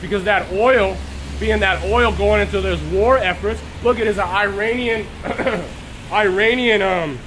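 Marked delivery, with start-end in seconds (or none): none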